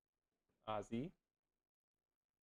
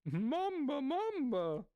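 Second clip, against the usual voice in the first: mock character cry; 13 semitones higher, much slower than usual.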